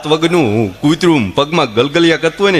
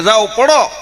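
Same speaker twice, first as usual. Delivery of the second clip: mock dare; 6 semitones higher, slower than usual. Husband dares wife to jump.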